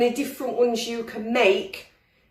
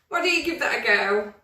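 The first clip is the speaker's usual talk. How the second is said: ordinary talk, nothing marked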